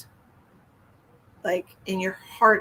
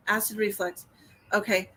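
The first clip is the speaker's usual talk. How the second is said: ordinary delivery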